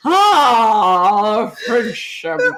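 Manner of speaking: long drawn-out "oh" into the slogan; drunk Orson Welles. Retold or imitated. Repeating the ad line for her friend, drunk. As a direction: stretch it like a boozy pitchman. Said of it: "Orson Welles".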